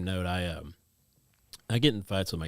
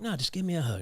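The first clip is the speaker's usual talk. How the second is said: coaxing dad; 10 semitones higher than usual. Retold or imitated.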